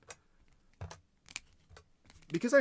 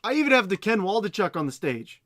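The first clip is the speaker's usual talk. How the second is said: loud mock-grateful sarcasm; sarcastic; 3 semitones higher, 8 dB louder than usual. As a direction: boom it out with fake gratitude. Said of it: "I can't even say it with a straight face".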